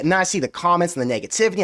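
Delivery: usual speaking voice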